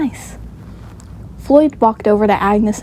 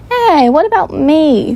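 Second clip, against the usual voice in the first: high, slow, drawn-out complaint; indignant child; 6 semitones higher, 4 dB louder than usual. A narrator's reading. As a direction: whine for your share. Left-out child demands her share.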